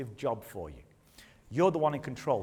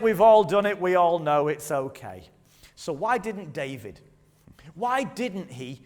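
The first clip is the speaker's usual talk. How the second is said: ordinary talk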